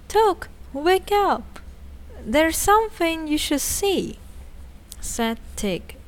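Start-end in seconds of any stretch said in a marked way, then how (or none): 0.1-4.2 calling out. A narrator's reading. Tick waking sleeping Took.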